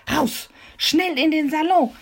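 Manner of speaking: high, loud cry to run; urgent shout. Acted. Mimi shouting to Peter to flee. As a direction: yell at Peter to get out fast. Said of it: "schrie Mimi Peter zu".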